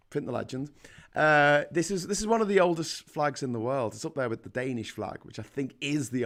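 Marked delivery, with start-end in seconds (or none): none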